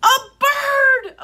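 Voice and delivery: high, loud shriek of alarm; shrieked storybook climax; panicked shout. A narrator's reading. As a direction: scream the danger out. Climax of the frightened warning.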